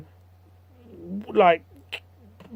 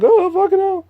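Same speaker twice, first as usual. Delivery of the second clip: much higher, strained comic yelp of pain; shrill pained cry; 4 dB louder than usual. Acted. Jack knocked over by Orlando, high pained yelp. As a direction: squeal it like a thug who just got flattened.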